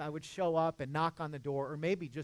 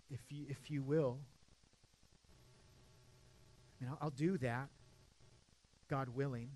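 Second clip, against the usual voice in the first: softer, lower, intimate confiding; soft pastoral; 6 semitones lower, 9 dB quieter than usual. Real, not acted. Preacher confiding gently to his driven congregation. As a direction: lean in and speak gently to the church.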